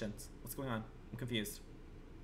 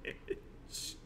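whispered insult; 14 dB quieter than usual. Real, not acted. Playful jab at chat.

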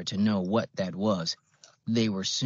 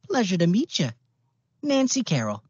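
high, louder, folksy Midwestern greeting; a chirpy old Midwestern accent, pitched up; old-fashioned Midwestern accent; 10 semitones higher, 5 dB louder than usual. A narrator's reading. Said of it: "old-fashioned Midwestern accent".

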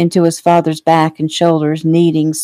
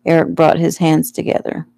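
plain reading voice

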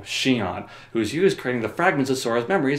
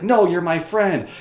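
higher, slow, drawn-out refusal; pleading protest; 6 semitones higher, 4 dB louder, slower than usual. Acted. Refusing to harm a friend, mock pleading. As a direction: protest in hammed-up heartbreak. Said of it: "Roxas is like".

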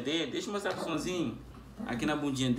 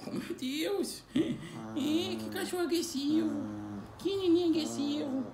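levemente aguda, carinhosa; vozinha para o pet; 6 dB louder than usual. Acted. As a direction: mime o cachorro com voz de bebê. Fala manhosa com o cachorro. (leve voz de bebê para o cão)

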